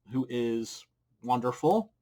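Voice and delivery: normal talking voice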